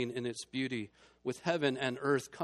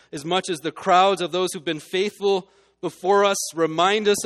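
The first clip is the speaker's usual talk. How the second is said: louder and building; rising preaching; 5 semitones higher, 10 dB louder than usual. Acted. Preacher turning the congregation's eyes to Jesus, building fervent preaching. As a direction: push the sermon up to its point.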